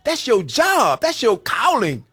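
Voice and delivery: loud, high impassioned preaching; rising sermon fervour, building to a peak; preaching at full force. Acted. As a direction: preach it out to the whole church. Preaching to the congregation.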